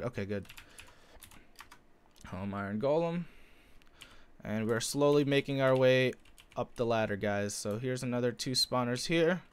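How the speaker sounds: usual speaking voice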